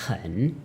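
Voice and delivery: "hen" soft, low, dipping then rising; teacher tone. Shown as a demonstration. Teacher demonstrating the next word, "hen" dipping then rising. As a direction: scoop "hen" down and up. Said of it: "also a teacher tone".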